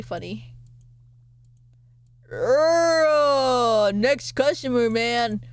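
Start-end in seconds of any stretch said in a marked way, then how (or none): 2.3-3.9 long rising "urgh", loud; exaggerated wordless growl-groan; loud drawn-out groan. Real, not acted. Wordless cry before next customer.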